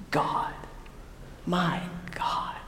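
very soft, reverent whisper; hushed confession of faith; awed whisper. Acted. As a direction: breathe it out in wonder, pausing between words. Thomas's awed confession re-voiced.

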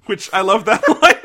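laughing loudly through the words; real laughter. Real, not acted. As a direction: crack up as you say it.

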